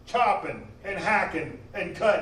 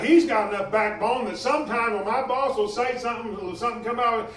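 ordinary delivery

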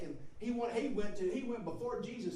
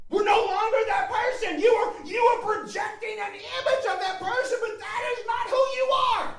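high, loud, driving sermon delivery; impassioned pulpit shout; full-force preaching; 12 dB louder than usual. Real, not acted. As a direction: preach it out at the top of your range.

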